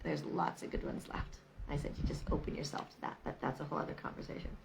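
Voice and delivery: hushed, whispered retort, fast at the end; whispered aside. Retold or imitated. Telling a stranger there are good men left, whispered. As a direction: drop to a whisper. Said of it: "And I was like".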